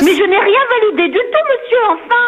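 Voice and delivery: real exasperation, pitch jumping up; indignant protest. Real, not acted. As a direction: protest in high-pitched disbelief. Wrongly credited with approving, protests.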